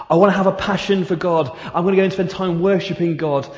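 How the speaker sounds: zealous vow to God, pushed out; eager declaration. Acted.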